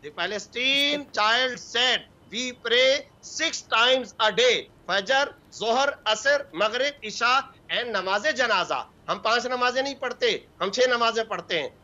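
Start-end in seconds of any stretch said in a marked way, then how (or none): 0.0-8.8 very slow, spaced-out words; slow, emphatic quoting. Retold or imitated. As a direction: read the placard out word by word, weighing each. Reads out child's placard message.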